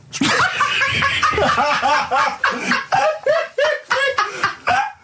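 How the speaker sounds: long helpless high-pitched laugh; a long squealing cackle; shrieking high laugh. Real, not acted. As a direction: scream with laughter. Carl says man moths.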